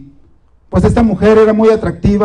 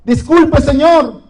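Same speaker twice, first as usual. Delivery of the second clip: higher, slowed, tearful pleading; llorando; 6 semitones higher, slower than usual. Acted. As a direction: beg him, a touch of tears in the voice. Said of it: "llega corriendo la mujer llorando y dice". (súplica llorosa, más aguda y lenta)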